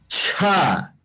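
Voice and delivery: "Cha" with a long puff of air after the ch; aspirated ch. Shown as a demonstration. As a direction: model "Cha" with a breathy release of the ch. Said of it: "voy a leer yo y cada uno de ustedes va a pronunciar".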